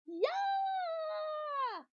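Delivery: very high, loud shriek; scream. Acted. Leslie shrieking out of nowhere in class, very high and loud. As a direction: shriek it at the top of your lungs. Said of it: "Leslie screamed".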